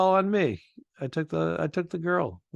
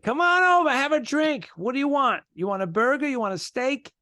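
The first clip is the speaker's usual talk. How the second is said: much higher-pitched eager host; welcoming local; 11 semitones higher than usual. Retold or imitated. New Englander offering guests food and drink, high eager welcome. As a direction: imitate a New Englander insisting you join in. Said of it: "Then they're like".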